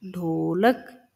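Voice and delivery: stretched syllable by syllable; ढोलक drawn out for dictation; slow dictation. Shown as a demonstration. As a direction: spell out ढोलक slowly, ढो-ल-क. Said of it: "वो है ढोलक का".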